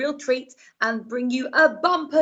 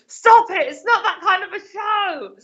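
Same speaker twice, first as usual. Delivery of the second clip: scolding producer; 11 semitones higher, 4 dB louder than usual. Retold or imitated. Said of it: "being like".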